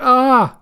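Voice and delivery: high, loud acted "ah"; short cartoon scream. Reaction to a sumo attack.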